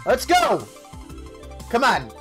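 usual speaking voice

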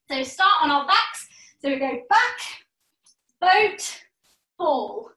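calling out each cue; called cues. Shown as a demonstration. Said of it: "just watch me first".

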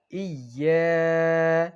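ইয়া held long and pressed hard on the doubled ইয়া; firm ইয়া. Shown as a demonstration. Teacher showing students the mistake spot, firm stress. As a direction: lean hard into ইয়া and hold it. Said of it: "শক্ত করে বলবেন".

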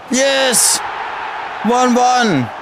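joyful shout for the goal; goal celebration. Real, not acted. Celebrating the equaliser.

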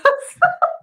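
shrill burst of giggles; high squealing laugh. Real, not acted.

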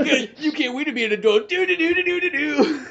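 high, laughing singsong gloat; gloating taunt. Acted. Adult buyer skipping away from kid, gleeful gloating. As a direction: rub it in, laughing into a silly tune.

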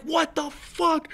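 high, slow, drawn-out shout; loud re-enacted disbelief; incredulous yell. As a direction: imitate a startled soldier yelling. Re-enacts startled soldiers challenging him.

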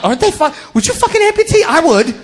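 normal talking voice

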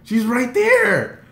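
high, loud, stretched fangirl cry; starstruck cry. Retold or imitated. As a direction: cry out high in disbelief at your idol. Starstruck fan reliving the sighting.

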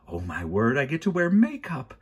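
rising to a high, delighted gush; thrilled. A narrator's reading. Judge learning she gets makeup, thrilled. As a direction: let the delight climb to the end.

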